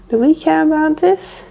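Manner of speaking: ordinary talk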